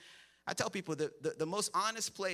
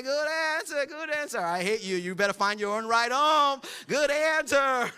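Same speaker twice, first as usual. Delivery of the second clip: forced grin; 5 semitones higher, 6 dB louder than usual. Retold or imitated. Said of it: "they're just like".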